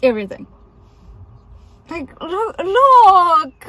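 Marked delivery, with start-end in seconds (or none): 1.9-3.7 excited. Real, not acted. Excited about the chickens.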